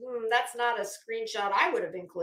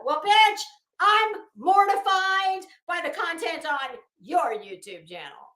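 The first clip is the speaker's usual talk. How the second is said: angry comeback; 5 semitones higher, 6 dB louder than usual. Real, not acted. Sarcastic comeback to the complaint.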